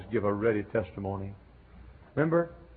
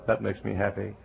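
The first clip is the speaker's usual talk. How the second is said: ordinary talk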